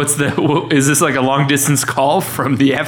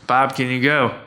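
ordinary talk, nothing marked

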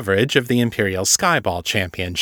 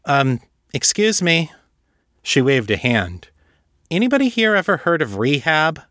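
put-on higher, bright young woman interrupting; 6 semitones higher than usual. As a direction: chime in, raising a hand. Misty's character voice.

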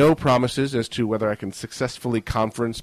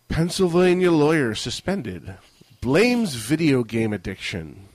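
higher, punchy news headline; headline read; 5 semitones higher, 4 dB louder, slower than usual. Acted. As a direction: announce it like a newscaster. Reads headline aloud.

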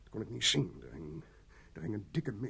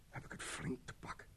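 whispered, breathless confession; 16 dB quieter than usual. Acted. Mitchell admits to the sergeant that the fear has caught him, in a whisper. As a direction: let the fear drain the voice to a whisper.

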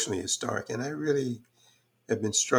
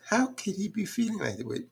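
incredulous question; 11 semitones higher than usual. Retold or imitated. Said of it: "I said".

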